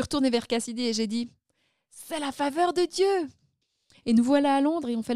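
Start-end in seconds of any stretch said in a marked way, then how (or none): 1.9-3.3 retold triumphant cry, higher and slower; exclamation émerveillée. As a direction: exclaim it in delighted wonder. (exclamation ravie, voix haute et posée)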